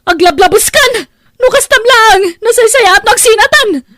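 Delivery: high, loud crying shout; sobbing scream. Acted. Wife screaming through tears that she is leaving.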